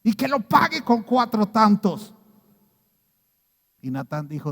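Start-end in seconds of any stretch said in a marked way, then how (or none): 0.0-2.2 loud, high, slow furious verdict; a king raging at the rich man's crime; angry king. A narrator's reading. King David condemning the rich man, loud angry verdict. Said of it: "David se enojó y dijo".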